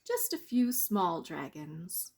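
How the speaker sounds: ordinary delivery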